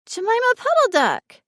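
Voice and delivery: put-on high, loud child's exclamation; little girl calling out. A narrator's reading. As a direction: shout it out like an excited small girl. Child names the picture loudly.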